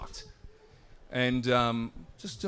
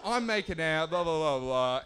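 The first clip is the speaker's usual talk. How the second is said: ordinary talk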